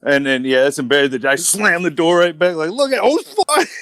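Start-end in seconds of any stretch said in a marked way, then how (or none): 2.7-3.7 higher, startled yelp of a curse; embarrassed surprised outburst. Retold or imitated. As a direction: blurt it out, caught off guard. Caught at porta potty door.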